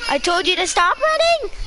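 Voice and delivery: high, worked-up play-scolding; mock angry scolding. Player ordering a runaway to the ground, mock outrage.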